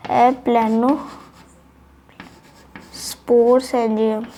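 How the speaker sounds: एप्लेनोस्पोरेंजियम drawn out slowly while writing; slow spelling out. Shown as a demonstration. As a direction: stretch एप्लेनोस्पोरेंजियम syllable by syllable as you write. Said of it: "इसे सही लिख लेता हूँ". (एप्लेनोस्पोरेंजियम धीरे-धीरे लिखते हुए खींचकर)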